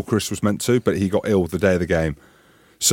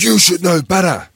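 angry coach; 8 semitones higher, 4 dB louder than usual. Retold or imitated. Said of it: "He said".

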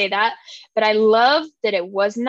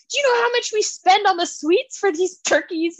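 exasperated mom quote; 8 semitones higher than usual. Retold or imitated. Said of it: "he's like".

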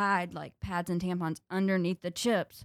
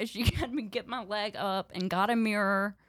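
ordinary delivery

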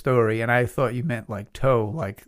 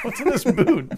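high, laughing quote; laughing mimicry; 12 semitones higher, 4 dB louder than usual. Listener mimicking the friend finding the boot, laughing. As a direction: imitate the curious friend through laughter.

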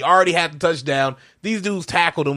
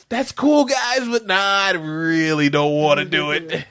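reluctant player; slower than usual. Retold or imitated. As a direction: voice a kid nervously begging off. Voicing reluctant player refusing speech.

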